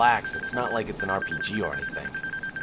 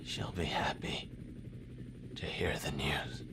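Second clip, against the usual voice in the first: wounded, barely voiced; faint injured whisper; weak whisper; 5 semitones lower, 7 dB quieter than usual. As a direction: breathe the words out in pain. Wounded soldier fading out.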